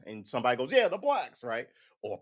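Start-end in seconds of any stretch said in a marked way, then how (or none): 0.7-1.4 higher, louder bigot echo; hammed-up yes-man; mock eager agreement. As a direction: chime in high, imitating a keen follower. Mimics someone eagerly agreeing.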